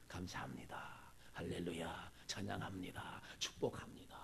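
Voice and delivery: soft whispered gratitude, barely voiced; whispered thanks. Grateful believer murmuring thanks to God in a hush.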